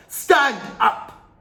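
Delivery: loud, high furious command; barked order. A narrator's reading. Trunchbull ordering Matilda to stand up. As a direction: snap the order out.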